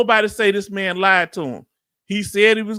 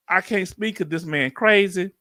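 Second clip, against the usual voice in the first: ordinary delivery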